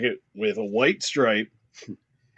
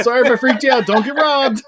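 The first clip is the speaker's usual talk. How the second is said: higher, louder, breezy customer goodbye; mock customer; 10 semitones higher, 5 dB louder than usual. Customer mock-thanking the clerk on the way out, hammed-up. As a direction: toss it off like a cheerful customer.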